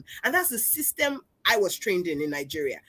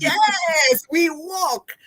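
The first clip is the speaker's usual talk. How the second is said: high, loud, drawn-out yes; loud, high-pitched insistence; emphatic agreement; 8 semitones higher, 5 dB louder, much slower than usual. Real, not acted. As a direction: exclaim it with full conviction. Emphatic agreement amid laughter.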